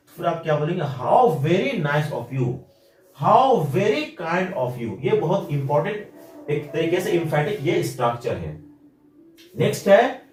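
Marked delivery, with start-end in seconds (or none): 0.8-5.0 very slow, pressing on "how very"; emphatic how very. Shown as a demonstration. Teacher repeats the emphatic compliment slowly. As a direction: stretch out each word for emphasis. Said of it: "एम्फैटिक या किसी चीज पे जोर देकर बोलना हो".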